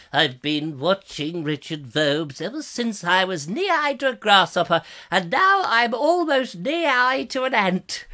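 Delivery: slow, drawn-out put-on elder voice; old long-time viewer. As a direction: creak it out slowly, rising in pitch. Reads viewer comment as old man.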